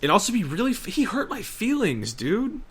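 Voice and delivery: high-pitched mock-wounded whine; mock hurt. Mock-hurt complaint about the gamer.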